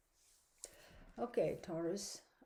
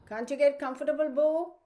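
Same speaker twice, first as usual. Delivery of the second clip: higher and louder, fondly scolding the dog; calling to the dog; 8 semitones higher, 8 dB louder than usual. Real, not acted. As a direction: call over to the restless dog across the room. Talking to her groaning dog.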